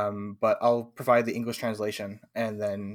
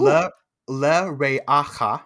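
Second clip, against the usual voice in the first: "Le re'acha" slow, each syllable apart; teacher models "Le re'acha" deliberately; staccato; 3 semitones higher, 6 dB louder, slower than usual. Shown as a demonstration. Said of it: "We'll do one word at a time".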